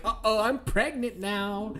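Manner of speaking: a hint of a girly voice; mock girlish worry; woman's voice. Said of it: "it's like".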